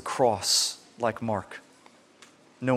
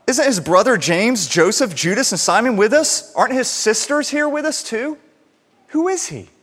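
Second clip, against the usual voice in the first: scoffing townsfolk; 6 semitones higher, 4 dB louder than usual. Retold or imitated. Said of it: "They're like".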